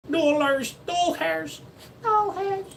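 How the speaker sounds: high, climbing squeak; silly high voice. Pretend. Plays with the word dollars.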